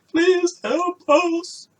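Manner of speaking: high, slow, loud plea for rescue; desperate cry for help. Acted. Trapped family begging the listener for rescue, desperate scream.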